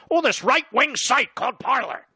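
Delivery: high, loud mock-alarmed sneer; mocking the media. Host mocking the left-wing media's shock about Parler, high loud sneer. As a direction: ham up the shocked media pundit.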